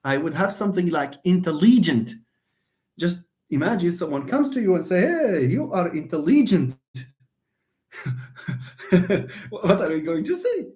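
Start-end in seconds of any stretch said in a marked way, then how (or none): 1.2-2.1 "intelligent" as in-te-LEE-gent; misplaced stress on LI. Shown as a demonstration. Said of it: "I stress the next one". 5.0-6.8 acted greeting with "intelligent" as in-te-LEE-gent; "intelligent" wrongly stressed on third syllable LEE; misplaced stress on LI. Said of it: "stress is not properly assigned".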